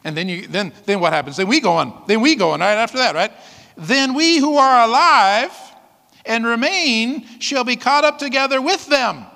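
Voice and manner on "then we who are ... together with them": high, loud, slow preaching of the verse; fervent scripture reading. Acted. Preacher reading Thessalonians to the congregation, fervent and slow. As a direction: proclaim the promise slowly.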